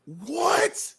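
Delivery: high, rising note of disbelief; astonished. Real, not acted. Co-host hearing the rhino story, astonished. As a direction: let the question shoot up in amazement.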